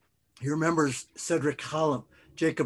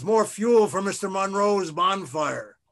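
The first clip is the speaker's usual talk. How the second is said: high, raised-voice rallying call; yell; 5 semitones higher, 4 dB louder than usual. A narrator's reading. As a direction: keep yelling to feed the fire. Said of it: "he yells".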